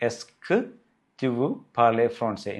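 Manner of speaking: very slow, every word spaced; slow French model. Shown as a demonstration. Teacher modelling the informal question slowly for students. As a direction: say it slowly so learners catch each word.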